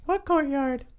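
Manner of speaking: high, squeaky creature question; puzzled kobold. Acted. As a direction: ask as a confused little kobold. Game master answers as kobold.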